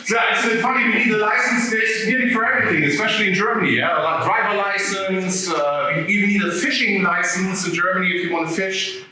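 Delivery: ordinary talk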